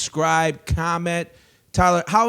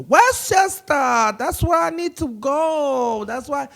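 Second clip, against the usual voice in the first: Mauricia; 12 semitones higher, 4 dB louder, slower than usual. Retold or imitated.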